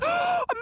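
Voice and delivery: sharp, very high gasp of joy; delighted gasp. Retold or imitated. Teacher spotting her former student, thrilled gasp. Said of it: "she'd just be like".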